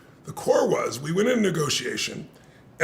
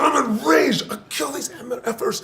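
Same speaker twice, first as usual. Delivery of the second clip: high-pitched mock-furious rant; worked-up retold fury, tumbling into blahs; intense rage; 8 semitones higher than usual. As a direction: imitate an enraged partner ranting on. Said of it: "he was so intense".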